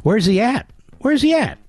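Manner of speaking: high mocking quote, climbing; mocking people. Host mocking TV people who misuse grammar. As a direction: mimic the sloppy question, voice rising high. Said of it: "people say".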